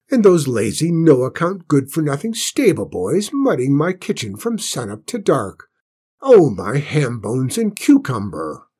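rustic cook ranting; loud, rough cook grumbling; rough country cook. Acted. Cook rants.

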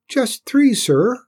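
very high, loud little voice; small squeaky Cinders. A narrator's reading. Cinders checks Tim's order, high squeaky voice. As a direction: question it high and deferentially.

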